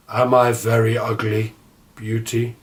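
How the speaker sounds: a shy monster asking if he's ugly; Beast's voice. A narrator's reading. Beast asks Beauty a question.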